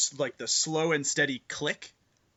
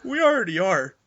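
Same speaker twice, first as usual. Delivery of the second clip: steady, no fear; 5 semitones higher, 4 dB louder than usual. Acted. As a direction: say it plainly and sincerely without a waver. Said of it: "no fear in his voice".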